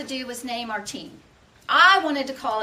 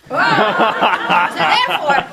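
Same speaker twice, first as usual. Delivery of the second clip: laughing; 9 dB louder, much slower than usual. Real, not acted. Laughing wrap-up.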